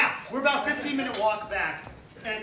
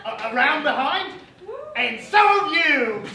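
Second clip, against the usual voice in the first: loud theatrical character voice, rising higher; stage character; 5 semitones higher, 10 dB louder, slower than usual. Acted. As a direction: build to a big, drawn-out accusing cry. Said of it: "a brief montage of every character".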